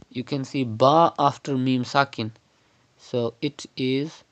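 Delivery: ordinary talk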